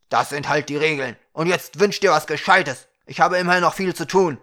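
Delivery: loud, insistent, speeding up at the end; impatient Ukra. A narrator's reading. Busy Ukra urging the dwarf to wish, loud and impatient. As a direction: push the dwarf along loudly.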